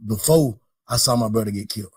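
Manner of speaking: normal talking voice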